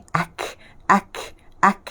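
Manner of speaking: "ak" ending in an airy k; kaf sound. Shown as a demonstration. Teacher shows pupils the kaf, airy "ak". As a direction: let a puff of air follow the k in "ak". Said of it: "Itu bezanya sebutan qaf dengan kaf".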